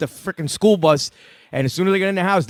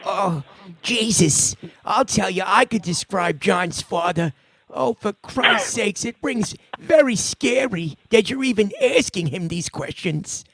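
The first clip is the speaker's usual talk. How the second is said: gruff in-character bit; 4 semitones higher, slower than usual. Pretend. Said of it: "all kidding aside".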